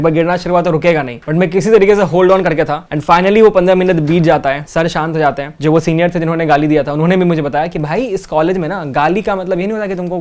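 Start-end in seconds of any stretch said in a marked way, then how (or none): none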